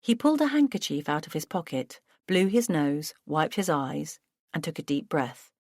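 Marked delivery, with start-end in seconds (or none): none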